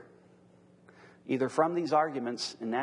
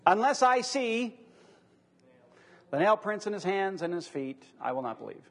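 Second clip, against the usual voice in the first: doubting Thomas; 7 semitones higher, 4 dB louder than usual. Retold or imitated. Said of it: "What was it?".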